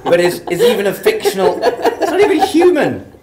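laughing through the words, high and loud; teasing while cracking up. Real, not acted. Teasing co-star's cat answer.

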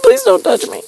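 tiny high, soft squeak; mock timid plea. Stuck rubbing elbows in ratio, mock timid plea. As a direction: plead very high and small, shrinking away. Acts out shrinking from ratio crowd.

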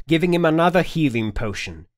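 ordinary delivery

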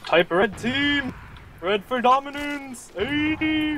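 gung-ho. Real, not acted. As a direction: pump the team up, slow and big. Team hype before match starts.

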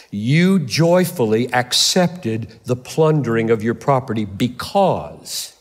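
slow each word down to let it sink in; measured, unhurried scripture reading; slow deliberate reading. Shown as a demonstration. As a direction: read it slowly, word by word. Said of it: "Let me read it again slowly".

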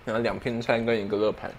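speaking between bites; chewing while talking. Real, not acted. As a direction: mouth partly full of food. Seen 3: Bites lettuce at -2.0 s; chewing mouth visible while talking to 1.5.

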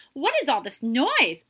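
indignant demand. A narrator's reading. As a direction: demand an answer over the commotion. Host demands to know about commotion.

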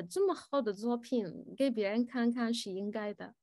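ordinary talk, nothing marked

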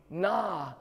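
normal talking voice

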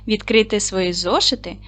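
ordinary talk

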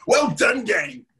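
ordinary delivery

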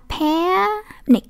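"panic" split, with a long rising "pa" before "nic"; open E drawn out. Shown as a demonstration. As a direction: stretch the open e sound in "panic", then add "nic". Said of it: "cái âm E này đọc ra nhé". (kéo dài âm E trong "panic")

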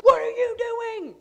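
loud, high-pitched road-rage yell, acted out. Driver to the guy on her butt, yelling. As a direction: holler it at the car behind. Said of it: "Yelling at him".

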